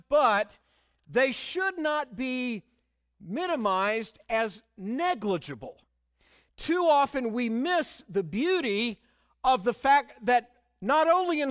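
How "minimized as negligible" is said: ordinary talk, nothing marked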